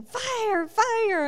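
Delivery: retold usher's high excited fire fire; imitating an excited usher. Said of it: "he just kept saying".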